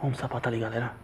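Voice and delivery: tense near-whisper in the dark; scared horror game streamer. Real, not acted. Scared hushed exploration.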